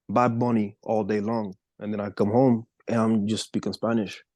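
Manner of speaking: ordinary talk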